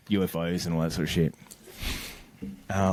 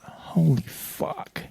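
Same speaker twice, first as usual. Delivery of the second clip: slow, drawn-out stunned swearing; retold moment of dumbstruck recognition; stunned awe; 7 semitones higher, much slower than usual. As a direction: let each word stretch out in disbelief. Stunned reaction at front gate in story.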